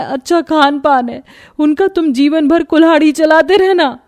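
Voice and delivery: loud, aggrieved wife's tirade; unhappy quarrelling. A narrator's reading. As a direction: scold your husband out of hurt. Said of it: "दुखी मन से पति से झगड़ा करने लगी".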